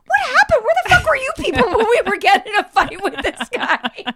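high, loud, laughing outrage; exasperated shout breaking into laughter; mock indignant retort. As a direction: throw their question back loudly.

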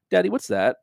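imitated young daughter. Six-year-old walks in behind dad during the movie, lightly imitated child voice. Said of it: "I hear behind me her voice go".

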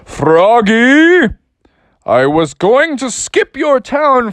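a prankster cloud delighted by the dance; big laughing sky voice; booming cloud voice. Acted. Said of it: "the clouds started to speak".

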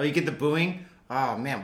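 usual speaking voice

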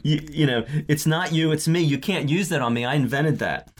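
imitated flustered objection; upset. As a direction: stumble over the words in mock indignation. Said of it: "He gets so upset with her".

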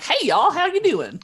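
high, loud Southern greeting; Southern lizardfolk accent. Acted. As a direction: holler a friendly Southern hello. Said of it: "his accent actually in Lizard Folk is like".

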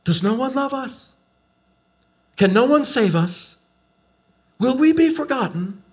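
very high, anguished pleading; bitter weeping. Acted. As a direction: plead as if weeping, in mock despair. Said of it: "he wept bitterly".

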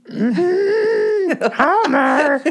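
very high, loud cartoon wife call through laughter; Marge impression. He does the cartoon wife calling her husband, laughing. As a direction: cry out her husband's name like an exasperated cartoon mom. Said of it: "now I'll do Marge".

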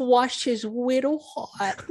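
put-on high, slow baby lisp; uwu speak. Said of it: "uwu speak".

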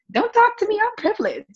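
high, snooty and dismissive; mock haughty. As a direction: play the privileged diva. Playfully haughty toward attorneys.

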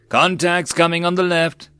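high, urgent battle call; narrator's contained yell; yelled warning. A narrator's reading. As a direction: call out the sighting to the troops. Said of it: "a yell cried out".

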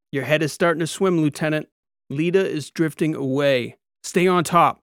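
building to a firm command; urgent coaching. A narrator's reading. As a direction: urge the trainee to hold on. Instructor urges trainee to stay conscious.

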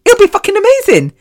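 high, fast and thrilled; excited burst. Real, not acted. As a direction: blurt it out in delight. Dreams of shedding all baggage.

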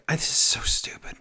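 breathy whispered aside. Real, not acted. Host whispering to co-host that the show is stupid. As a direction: mutter it under your breath.

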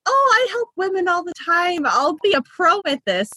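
high, breezy know-it-all confidence; hammed-up overconfident new nurse; mock arrogance. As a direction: act like a cocky pro who's seen it all. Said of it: "You have the arrogance".

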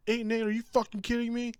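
high, loud, indignant outburst; outraged fan. Retold or imitated. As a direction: scoff like an offended fan.

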